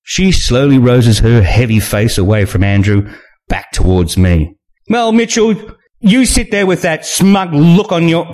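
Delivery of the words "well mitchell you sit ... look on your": high-pitched sneering woman at a dinner party; Maxine's voice. Acted. Maxine taunting Mitchell at the table, high and sneering.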